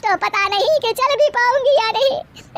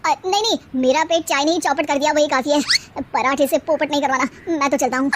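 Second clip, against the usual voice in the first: Sharma ji, guest; 6 semitones lower than usual. Acted. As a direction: lower male voice, politely backing out. Sharma ji, lower voice.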